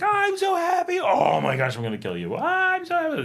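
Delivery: high-pitched ecstatic cry swinging wildly; hammed-up emotional outburst, leaping high on happy; over-the-top passion. Shown as a demonstration. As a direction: act out huge mood swings, shrieking the joy. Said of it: "here it's like".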